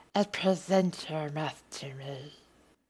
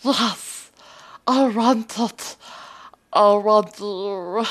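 mock loud moaning; 3 semitones higher, 8 dB louder than usual. Mock dirty talk, swelling.

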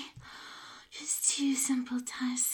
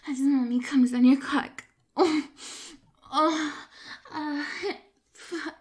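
orgasm moans; 4 dB louder than usual. Acted. Performer acts out climax moans.

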